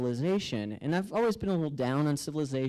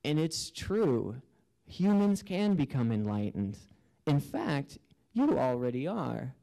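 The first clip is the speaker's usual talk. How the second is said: slow revealing voice; slower than usual. Retold or imitated. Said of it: "And they start saying".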